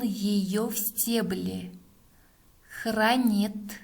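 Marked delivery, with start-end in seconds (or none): 2.8-3.8 word by word with long pauses; dictating. Shown as a demonstration. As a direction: dictate slowly, one word at a time. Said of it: "Следующее предложение". (диктует по словам)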